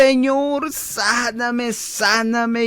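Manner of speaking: high, drawn-out cry to God; acted desperate prayer, high-pitched; pleading sick person. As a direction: plead for healing in a high, stretched voice. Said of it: "estando enferma dice".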